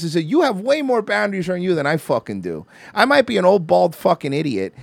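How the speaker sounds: usual speaking voice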